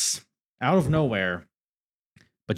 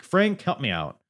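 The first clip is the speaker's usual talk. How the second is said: usual speaking voice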